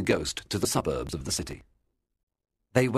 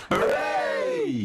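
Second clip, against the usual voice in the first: a burst of joy from the whole family; shouted hooray, way up high; children's cheer. A narrator's reading. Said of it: "everybody cried".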